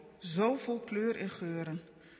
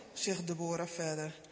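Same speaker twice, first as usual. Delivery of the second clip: ordinary delivery, nothing marked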